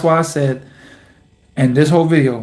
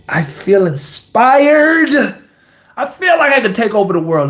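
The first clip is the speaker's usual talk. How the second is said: pitched up and fired up, high voice; excited; 5 semitones higher than usual. Real, not acted. Vlogger telling viewers his joy after a decision, excited and high. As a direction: let the excitement burst out, unable to hold it. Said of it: "I get a little excited sometimes".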